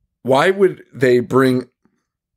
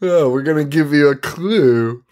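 lightly voiced teasing villain, pitched up; playful villain voice; 4 semitones higher than usual. Haunted house tipping off its victims, playful villain voice. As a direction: put on a hint of a scheming spook.

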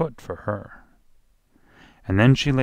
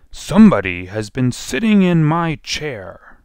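lightly voiced huge bear, loud and raised; rough, gruff bear; 6 semitones higher, 7 dB louder than usual. A narrator's reading. Huge bear finds his chair used, rough gruff voice. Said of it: "gruff voice".

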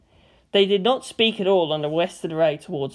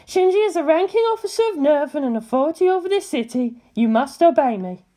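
higher, officious woman; commanding Misato; 9 semitones higher than usual. A narrator's reading. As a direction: pull rank on him. Misato asserting authority.

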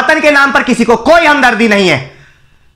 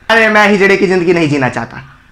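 ordinary talk

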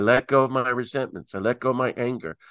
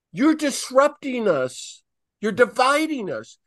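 higher, slow, indignant accusing; mock accusation; 9 semitones higher than usual. Birmingham segregationists accusing Martin Luther King, indignant and slow. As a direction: play the outraged townsfolk, lightly. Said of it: "and they're saying".

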